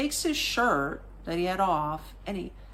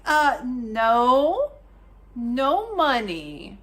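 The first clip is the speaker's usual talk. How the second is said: loud, higher, drawn-out no; emphatic refusal; 4 semitones higher, 6 dB louder, much slower than usual. Retold or imitated. Tourist turning down the begging boy, slow emphatic refusal. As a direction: stretch out the refusal slowly for a kid.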